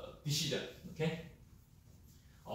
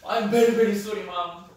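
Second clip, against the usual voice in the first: loud, high, pleading very very sorry; strongest apology; 6 semitones higher, 11 dB louder, faster than usual. Shown as a demonstration. Teacher demos the top-level sorry to mom, loud and pleading. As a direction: belt out the double very, begging. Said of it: "And then what is next?".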